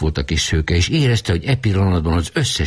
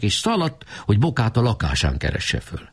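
usual speaking voice

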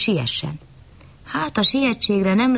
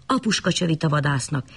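ordinary delivery

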